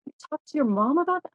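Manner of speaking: put-on high, incredulous kid voice; shocked friends. Friends stunned by what she tells her parents, high and incredulous. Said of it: "my friends would go".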